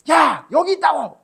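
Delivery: loud high yell for a pass; shouting for the ball. Retold or imitated. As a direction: yell it across the pitch, demanding the ball. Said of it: "이렇게 한다고". (패스 달라고 크게 외치는 소리)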